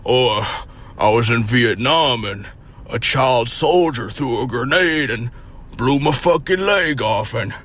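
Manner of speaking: slow, put-on veteran voice; imagined Vietnam vet. Pretending the boomer explains his drinking, slow veteran voice. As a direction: drag it out like a grizzled vet.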